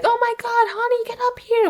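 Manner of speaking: very high put-on cry for husband; hammed-up alarmed call upstairs; mock upset wife. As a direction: imitate a panicked wife summoning help. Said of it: "gets rightfully upset and is like".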